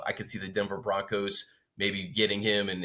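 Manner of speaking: ordinary talk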